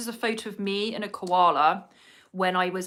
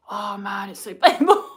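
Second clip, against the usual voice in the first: half laugh, half wince at the sting; laughing through pain; 7 dB louder than usual. Real, not acted. Stinging lip plumper on her lips.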